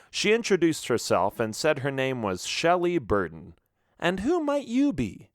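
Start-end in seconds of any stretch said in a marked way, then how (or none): 4.0-5.3 higher, lightly coy woman's voice; a mysterious young woman teasing; a stranger on the dock greets a pirate, higher and coy; lady's voice. A narrator's reading. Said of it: "she asked".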